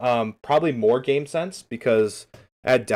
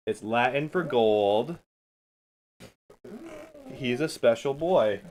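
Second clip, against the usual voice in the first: usual speaking voice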